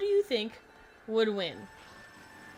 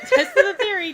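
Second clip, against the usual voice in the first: proud exclaim; 10 semitones higher, 12 dB louder than usual. Real, not acted. Proud of tripping on banana.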